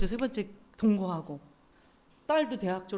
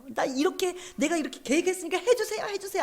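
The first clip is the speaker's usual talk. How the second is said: high-pitched mock tantrum plea; imitated pestering, pitched up high; childish begging; 8 semitones higher than usual. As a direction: beg like a child who won't take no. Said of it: "하나님 앞에 떼를 쓰고 있는 거예요".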